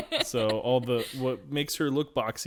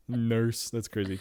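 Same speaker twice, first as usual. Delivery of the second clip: ordinary delivery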